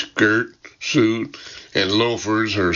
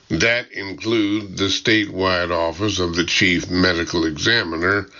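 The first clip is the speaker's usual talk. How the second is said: plain reading voice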